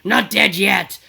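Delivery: loud mock protest. Old man insisting on taking the top, protesting. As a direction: call it out stubbornly, imitating an old man. Acted protest in freezer argument.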